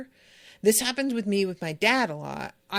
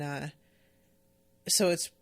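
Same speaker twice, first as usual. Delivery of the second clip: ordinary talk, nothing marked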